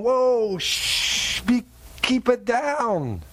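urgent hush, slow at the end; hushing Peter. Retold or imitated. Peter at the gate hushing the excited disciples. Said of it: "And Peter's going".

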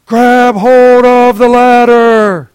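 high, loud, slow yell from above; pilot yelling. Acted. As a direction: bellow down over the rotor noise. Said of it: "the pilot yells down".

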